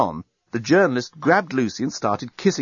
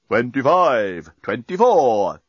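slow, measured countdown voice; bomb counting down; much slower than usual. A narrator's reading. Bomb counting towards detonation, slow and measured. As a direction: count down with drawn-out numbers. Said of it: "the bomb was counting".

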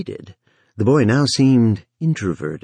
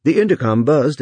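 plain reading voice

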